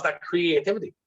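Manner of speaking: normal talking voice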